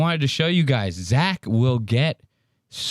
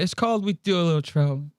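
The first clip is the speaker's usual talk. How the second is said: higher mock Trump, trailing off at the end; 4 semitones higher, faster than usual. Host copying the clip's trailing Trump delivery. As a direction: let the catchphrase fade like Trump does. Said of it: "I love the way he trails off".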